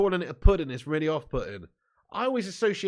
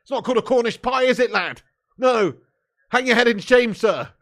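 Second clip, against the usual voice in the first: mock scolding shout; 7 semitones higher, 10 dB louder than usual. Real, not acted. Scolding chat, mock shaming.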